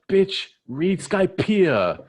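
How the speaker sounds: loud, high, stretched-out sass; mocking comeback. Recapper as Luffy answering Big Mom, loudly mocking. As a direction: yell it slowly with full mock scorn.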